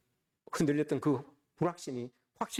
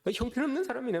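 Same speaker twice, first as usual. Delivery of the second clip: "형편없는 사람이네" tossed off higher, a sharper sneer; retold sneer pitched up high; imitating scornful disciples, pitched higher; mock scoff, higher; 8 semitones higher than usual. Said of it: "막 이렇게 이야기를 했을 거예요".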